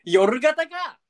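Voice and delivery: loud high retort; shouting. Acted. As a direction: snap the punchline out. (大声のツッコミ)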